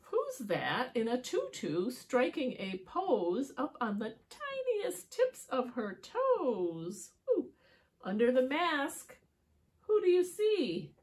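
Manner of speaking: singsong rhyming story narration; riddle for children. A narrator's reading. Asking children who is under the mask, playful storytelling. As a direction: build up to a teasing question.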